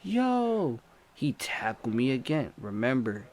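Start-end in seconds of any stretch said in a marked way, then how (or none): 0.0-0.8 hailing someone far off; calling out. Retold or imitated. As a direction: shout for the cousin down the block. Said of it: "And I'm waving".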